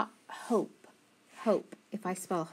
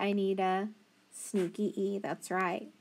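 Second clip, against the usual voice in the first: ordinary delivery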